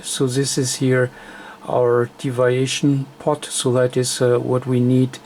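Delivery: usual speaking voice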